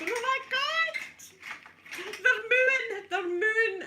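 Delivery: mock panic. To her ex-husband in the car, a hammed-up terrified shriek. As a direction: shriek it like the cows are about to get you.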